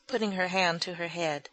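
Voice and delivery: usual speaking voice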